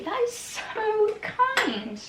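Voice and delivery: high, slow and tender; touched. Real, not acted. Thanking the cottage company for the gift, touched. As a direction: melt a little at the kindness.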